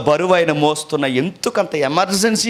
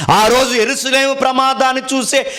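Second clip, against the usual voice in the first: urgent preaching; 7 semitones higher, 3 dB louder than usual. Acted. Preacher warns of the second coming.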